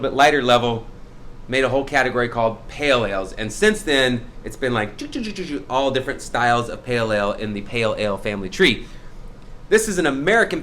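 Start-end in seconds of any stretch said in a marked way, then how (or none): 5.0-5.6 soft, higher sputtering choo-choo sounds; rapid choo noises. Shown as a demonstration. Host explaining the pale ale family tree, rapid sound effect. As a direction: rattle off quick light branching sound effects. Imitated noise of styles branching out.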